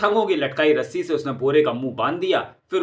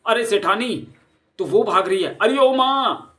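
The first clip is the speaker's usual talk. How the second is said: high, loud calling after her; loud desperate call to mother; 7 semitones higher, 3 dB louder than usual. Acted. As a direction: shout after her in pain. Hurt thug calls out to her.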